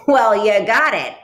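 loud, huffy retort; huffing. A narrator's reading. Duck retorts to the little bird, huffing. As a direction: huff it back with force. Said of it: "Duck huffed".

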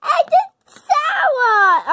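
high, slow laughing shout; shocked laugh. Real, not acted. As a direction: yelp it out through a laugh. Tasting sour pop.

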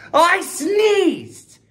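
high, loud exclamation; exclaiming. Shown as a demonstration. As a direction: say it with emphasis. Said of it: "first exclamation point needs to go after sneezed".

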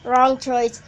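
loud. Real, not acted. Scolding the game.

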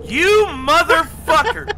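slow drawn-out angry yell; furious shout. Real, not acted. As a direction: roar it at a friend. Furious at teammate killing him.

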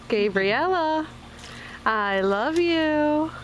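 tender. Real, not acted. Tender to baby.

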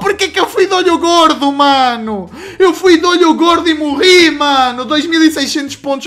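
frustrated gamer. Real, not acted. Lament after death.

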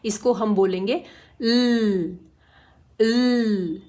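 ल as a held tongue-behind-teeth l, no vowel; l without vowel. Shown as a demonstration. Teacher showing kids the l phonics sound, ल held with no vowel. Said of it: "सिर्फ टंग को दांतों के पीछे लगा".

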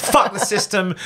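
high-pitched ranting in an English accent; mock yelling. Shown as a demonstration. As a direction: yell it as a stage rant, without much volume. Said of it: "just do my yelling about the system".

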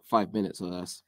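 normal talking voice

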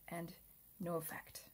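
hushed, breathy whisper. Real, not acted. Player losing his last soldiers, whispering. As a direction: whisper it as the squad falls.

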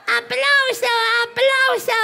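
high, loud showman's call; ringing demand to the audience; calling for applause. Real, not acted. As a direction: whip up the crowd.

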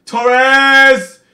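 high, loud yell of the name; excited shout. Real, not acted. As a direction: cheer him on at full voice. Shouting at the match on screen.

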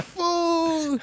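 high, drawn-out "Fool."; mock exasperation. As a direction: sigh it at him. Imagined exasperated reply.

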